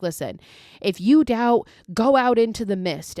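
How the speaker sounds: preaching. Retold or imitated. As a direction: warn the doubters like a fire-and-brimstone preacher. Zealot preaching threat at the crowd.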